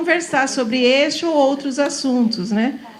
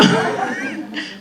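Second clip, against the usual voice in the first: a soft voiceless laugh, much higher; breathy high laugh; 4 dB quieter than usual. Real, not acted. Teacher laughing at her own quip, voiceless laugh.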